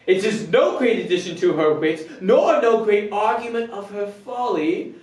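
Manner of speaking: astonished man reasoning himself into love; Benedict. Acted. Performing Benedict's monologue on learning he is loved, animated comic deliberation. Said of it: "Benedict's monologue".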